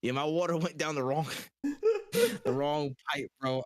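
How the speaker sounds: laughing through the words; chuckling as he explains. Real, not acted. Laughing about his choking fit.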